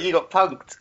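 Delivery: a light mock toff taunt; Boris gloating. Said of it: "And he's like".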